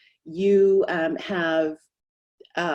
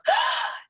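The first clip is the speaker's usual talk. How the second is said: sharp, high intake of breath; delighted baby gasp. Acted. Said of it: "Baby sits up in the crib and goes".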